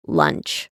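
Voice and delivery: low, plain call to lunch; welcome call. A narrator's reading. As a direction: announce lunch. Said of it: "Osprey utter the welcome phrase".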